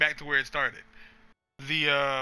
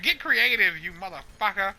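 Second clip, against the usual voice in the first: higher, louder dismissive snap; snappy retort; 6 semitones higher, 4 dB louder than usual. Acted. Banthony brushing Blaze off, higher dismissive snap. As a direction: toss it back at him with sass. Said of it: "And she's like".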